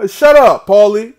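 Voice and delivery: a loud laughing shut-up aimed at Paulie; laughing taunt. Real, not acted.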